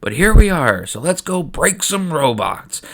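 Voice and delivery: half-hearted queen's rallying shout; rallying yell. Acted. Queen rallying troops before battle, with a mild mock yell. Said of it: "stands alongside Boss Nass and yells".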